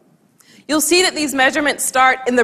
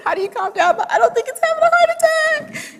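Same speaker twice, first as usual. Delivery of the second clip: much higher, mock exasperation climbing up; exasperated. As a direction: squeak it out in disbelief. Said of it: "And I'm thinking".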